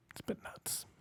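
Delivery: breathy whispered repeat. Shown as a demonstration. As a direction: drop to a whisper.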